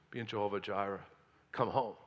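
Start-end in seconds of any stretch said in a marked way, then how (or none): none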